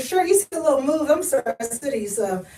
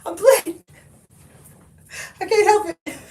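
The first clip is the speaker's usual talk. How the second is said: mock lament; 5 semitones higher than usual. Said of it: "I said".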